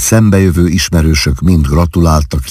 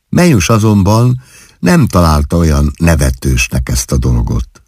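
ordinary delivery

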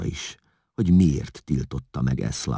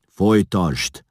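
mildly grim, louder command; 7 semitones higher, 7 dB louder, slower than usual. A narrator's reading. Attila ordering Vigilász to go on, mildly grim. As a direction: give a curt order with a touch of grimness. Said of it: "szólt komoran Attila".

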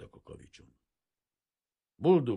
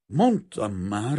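slow, louder, out of breath; panting; 4 semitones higher, 12 dB louder, much slower than usual. A narrator's reading. The father, breathless from the garden, to Kazimír, panting. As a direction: pant it out after running in. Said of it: "lihegte az áruház tulajdonos".

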